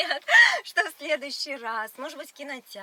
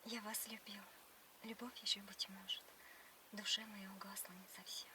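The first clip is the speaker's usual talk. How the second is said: whispered poem recitation; 4 semitones lower, 13 dB quieter than usual. Pretend. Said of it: "сначала ты стих расскажи мне".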